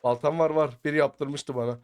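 normal talking voice

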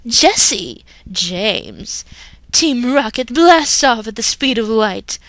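cartoon villains reciting their motto proudly. Team Rocket motto recital.

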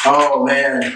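ordinary delivery

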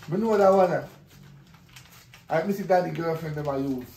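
usual speaking voice

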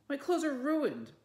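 high, loud wail of outrage; furious, tearful outburst; upset cry. A narrator's reading. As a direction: cry it out over ruined clothes. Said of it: "cried Felicity".

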